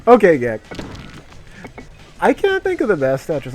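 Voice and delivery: ordinary delivery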